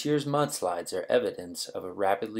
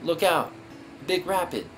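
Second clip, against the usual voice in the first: calling out warning; 7 semitones higher, 3 dB louder than usual. Acted. Warning paddlers of a rapid.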